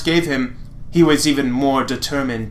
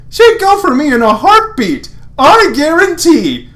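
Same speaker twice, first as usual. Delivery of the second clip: cocky Lance; 12 semitones higher, 7 dB louder than usual. Acted. Lance boasting to Hunk, high and loud. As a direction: brag it up high and draw out the promise.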